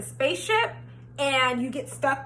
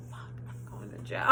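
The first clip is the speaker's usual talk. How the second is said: panicked whisper; 18 dB quieter than usual. Retold or imitated. Retold panic as cop arrives.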